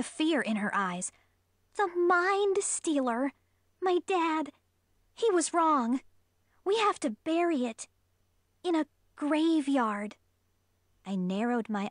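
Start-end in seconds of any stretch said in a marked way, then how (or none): 1.8-10.2 put-on high, urgent young girl; scared friend pleading; frightened girl voice. A narrator's reading.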